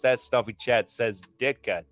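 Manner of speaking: usual speaking voice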